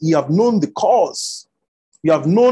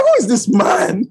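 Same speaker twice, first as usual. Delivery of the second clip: amazed; 7 semitones higher, 5 dB louder than usual. Retold or imitated. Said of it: "I'm like".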